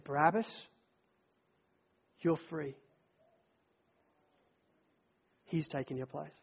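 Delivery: gentle. Acted. Soldiers release him softly.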